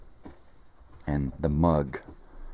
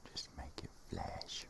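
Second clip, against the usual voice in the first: whispering; 17 dB quieter, slower than usual. Real, not acted. Whispering to self.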